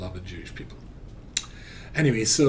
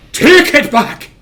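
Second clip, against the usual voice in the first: high, very loud final order; stern shout; 18 dB louder, much slower than usual. Retold or imitated. Strict mother cutting off her son, in a stern shout. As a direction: bellow the command.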